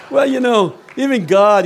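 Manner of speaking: ordinary delivery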